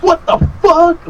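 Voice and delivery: very high, drawn-out exasperated cry; replayed high-pitched outrage; retold pissed off. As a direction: stretch it slow and high in disbelief. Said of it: "Like, pissed off".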